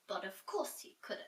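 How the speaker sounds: usual speaking voice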